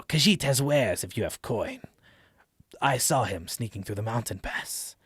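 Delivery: put-on accented cat-folk trader voice; Khajiit. Cat merchant offering wares to a traveler, accented cat-folk voice. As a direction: talk like a sly cat-person merchant. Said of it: "I always think Khajiit from Skyrim".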